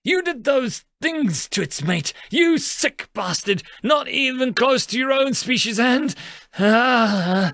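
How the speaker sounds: high-pitched raging tirade; enraged accusation. A narrator's reading. Youngest facing the fish-headed monstrosity, enraged accusation. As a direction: spit out the accusations in disgust and rage. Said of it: "in a visible display of rage".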